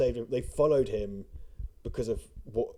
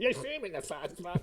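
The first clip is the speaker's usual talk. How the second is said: nitpicking commenter voice; 6 semitones higher, 3 dB quieter than usual. Retold or imitated.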